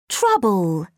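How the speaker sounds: "Trouble" said whole after the sounds; blended word. Shown as a demonstration. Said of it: "Blend the sounds".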